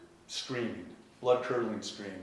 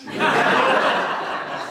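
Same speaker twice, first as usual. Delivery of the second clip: high, loud burst of laughter; amused outburst; 14 dB louder than usual. Real, not acted. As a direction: laugh at the punchline. Laughing at the morphine joke.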